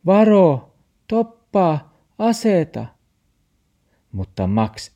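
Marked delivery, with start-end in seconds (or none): 0.0-2.9 high, loud cry of alarm; shouted warning. A narrator's reading. Olli warns Max on the road, high shouting. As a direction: call out each word urgently, high and loud. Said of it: "Olli huusi".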